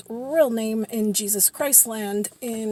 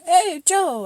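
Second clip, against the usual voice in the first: high, louder hello; cheery greeting; 8 semitones higher, 5 dB louder than usual. Retold or imitated. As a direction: call out brightly to a friend. Acted greeting to an acquaintance.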